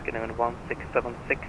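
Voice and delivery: ordinary talk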